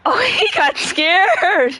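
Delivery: high laughing and teasing. Real, not acted. Laughs after scaring viewer.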